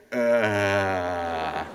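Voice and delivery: long, low, dumb "uhhh"; stupid-looking "uh". Shown as a demonstration. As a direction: drone a drawn-out uh, stuck for words. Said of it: "I look stupid".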